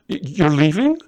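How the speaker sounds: high, loud stammered alarm. Acted. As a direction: blurt it out in alarm. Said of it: "In alarm".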